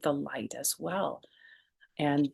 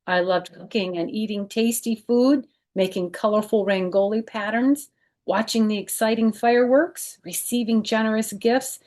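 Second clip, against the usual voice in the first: lively read-aloud storytelling voice; children's book narration; story narration; 3 semitones higher, 3 dB louder than usual. Acted. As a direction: read it to kids, bright and clear. Reads picture book aloud.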